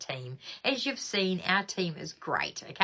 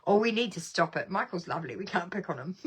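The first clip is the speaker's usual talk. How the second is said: ordinary delivery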